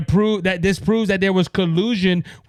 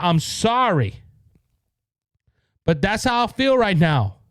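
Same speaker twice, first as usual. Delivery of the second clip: ordinary talk, nothing marked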